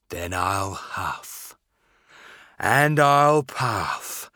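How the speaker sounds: slow, building to a loud blow; big bad wolf. Wolf threatens to blow house.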